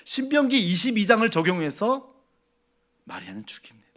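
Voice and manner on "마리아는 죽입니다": grave whisper. Real, not acted. Grave consequence stated.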